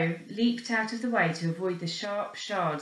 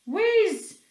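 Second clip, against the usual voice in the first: high, loud whizz; whizzing sound effect; whiz sound; 12 semitones higher, 6 dB louder than usual. A narrator's reading. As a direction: zip it past.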